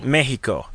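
"Mexico" said as MEH-hee-co; Spanish-style "Mexico", no ks sound; Spanish pronunciation. Acted. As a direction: say "Mexico" with h in place of x. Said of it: "you say it Mexico".

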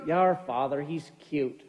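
low, soft and fond; fond mom. Retold or imitated. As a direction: say it like an amused, doting wife. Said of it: "She just goes".

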